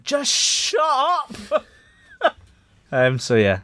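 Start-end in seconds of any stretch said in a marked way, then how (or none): 0.0-1.7 high, drawn-out mock yell of annoyance; hammed-up, high-pitched exasperation; mock exasperated cry. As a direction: imitate fed-up opponents, stretching the words out high. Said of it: "opposition teams are just going".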